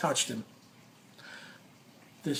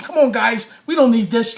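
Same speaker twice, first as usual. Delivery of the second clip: higher, louder mock peacemaker plea; pleading interns; 5 semitones higher, 6 dB louder than usual. The small interns beg the two men to calm down, higher and louder. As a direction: imitate the little interns begging them to stop.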